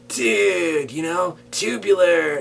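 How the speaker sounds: slow, high surfer dude drawl; Californian accent. Shown as a demonstration. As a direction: drag out tubular like a surfer. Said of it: "I could do a Californian one too".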